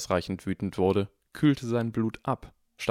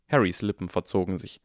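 ordinary delivery, nothing marked

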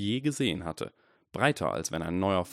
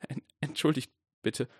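higher, voice shaking with tears; trembling voice; 7 semitones higher than usual. A narrator's reading. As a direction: hold back tears, voice trembling. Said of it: "sagte Harry mit zitternder Stimme".

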